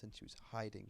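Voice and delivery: usual speaking voice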